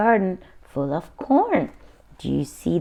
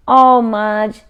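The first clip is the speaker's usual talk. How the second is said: louder, dismayed sigh at the dog; exasperated; 7 dB louder than usual. A narrator's reading. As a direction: scold the dog fondly. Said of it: "Henry said".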